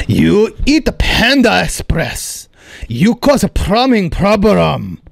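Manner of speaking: put-on slow Korean accent, accusing; drawn-out Korean accent, laying blame; Korean landlord accent. Landlord blames tenant for the clog, slow Korean accent. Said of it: "my Korean landlord".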